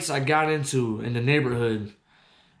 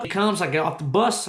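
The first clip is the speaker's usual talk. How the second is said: usual speaking voice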